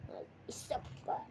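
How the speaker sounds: whisper. Real, not acted. Aside to self.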